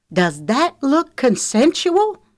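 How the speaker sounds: high, loud, drawn-out challenge; outraged demand. A narrator's reading. Lexi shows her injured jaw, outraged. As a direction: challenge them angrily.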